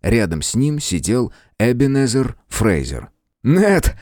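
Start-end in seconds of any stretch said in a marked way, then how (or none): 3.4-4.0 put-on high, eager boyish call; excited greeting, pitched way up; delighted exclamation. A narrator's reading. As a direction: cry out in glad surprise. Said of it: "воскликнул Том".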